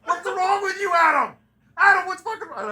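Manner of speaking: high, hammed-up friend shrieking at Adam; quoting a friend, high and horrified; mock horrified friend. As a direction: squeal it like a freaked-out buddy.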